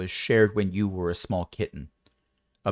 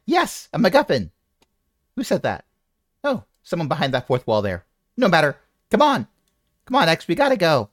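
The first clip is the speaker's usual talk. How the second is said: high excited NPC voice; 5 semitones higher than usual. Acted. NPC voice again.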